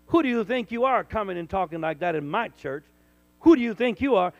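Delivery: loud, scornful mock challenge; imitating an offended church member, loudly; indignant challenge. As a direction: throw the church member's scorn back at them. Hostile church member's thought retold.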